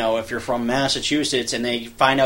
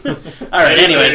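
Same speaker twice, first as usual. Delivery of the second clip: ordinary talk, nothing marked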